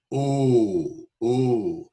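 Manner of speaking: "Oh" held as one long round vowel; steady monophthong "Oh"; pure O vowel. Shown as a demonstration. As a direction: repeat "Oh" drawn out, no glide. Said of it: "Where do you hear that?".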